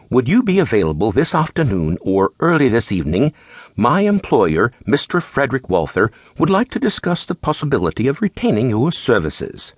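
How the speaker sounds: polite, lightly voiced English manservant; a mild English accent, formal and courteous. English butler on the phone offering a job, mild English accent. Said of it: "in an English accent".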